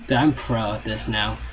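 ordinary talk

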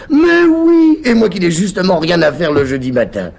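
high, loud eager outburst; eager cry. Acted. Topaz eagerly offering to take the walk, high and loud. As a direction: burst out delighted, very high and loud.

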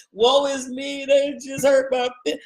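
mock self-pity. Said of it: "The tears are not tears of".

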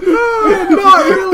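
high shrieking laughter; can't stop laughing; laughing hard. Real, not acted. Hysterical laughing at fish joke.

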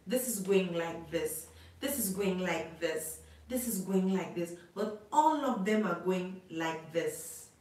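usual speaking voice

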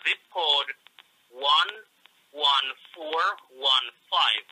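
slow and deliberate, each number set apart; slow dictation. Real, not acted. Man slowly dictating his address to the caller. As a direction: dictate the house number slowly, one piece at a time. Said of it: "what is your address?".

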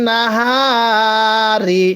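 নাহারি held very long, the হা stretched one alif; teacher's slow elongated নাহারি; drawn-out recitation. Shown as a demonstration. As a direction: draw out the হা in নাহারি slowly. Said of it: "সবাই আওয়াজ দিয়ে পড়তে হবে".

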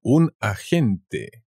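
Un agente drawn out very slowly, g as a throaty axente; teacher models Un agente for learners, very slow; very slow Spanish model word. Shown as a demonstration. Teacher models the word very slowly. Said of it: "An agent".

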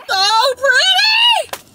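high, slow, loud gasp of delight; awed shout. Real, not acted. Spotting a pretty tree while driving, delighted shout. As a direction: cry it out in awe.

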